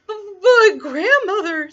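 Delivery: high, loud, stammered start; alarmed little girl. Acted. Little Red seeing sharp teeth, high alarm. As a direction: gasp it out in fright.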